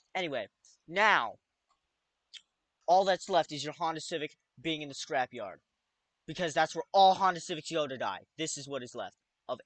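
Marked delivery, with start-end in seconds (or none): none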